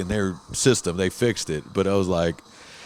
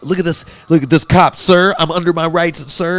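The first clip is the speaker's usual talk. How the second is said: higher, louder whiny filmer lecturing a cop; mocking cop-video filmer; 8 semitones higher, 6 dB louder than usual. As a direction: needle the cop in a put-on self-righteous voice. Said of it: "videos where it's like".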